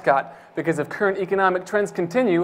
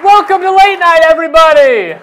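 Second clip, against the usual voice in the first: high, loud hyped welcome; excited shout; 9 semitones higher, 8 dB louder than usual. Acted. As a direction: burst on stage yelling with energy. Host bursts on stage.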